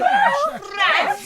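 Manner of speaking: high, loud exclamation; outraged disbelief. Acted. Manager accused of rats in hotel, outraged disbelief.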